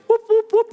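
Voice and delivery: very high whooping rotor noise. Acted. Teacher makes rotor sounds for preschoolers, very high whoops. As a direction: make the rotors sing.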